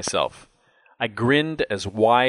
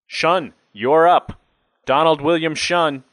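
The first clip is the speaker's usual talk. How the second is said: an officer calling a name from a window; 7 semitones higher, 4 dB louder than usual. A narrator's reading. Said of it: "officer at the window called my name".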